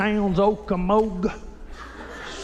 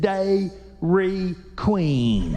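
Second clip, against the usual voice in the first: slow drawn-out answer; much slower than usual. Retold or imitated. Locals answer "real slow.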